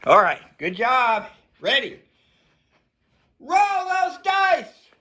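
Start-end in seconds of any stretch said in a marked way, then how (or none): 3.4-4.9 drawn-out excited call. Real, not acted. Coach announcing the dice roll.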